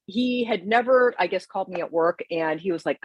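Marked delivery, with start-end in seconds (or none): none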